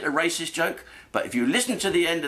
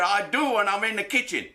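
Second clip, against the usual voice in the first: put-on higher-pitched caricatured Chinese accent; mock Chinese accent; 7 semitones higher than usual. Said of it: "he said with a stereotypical Chinese accent".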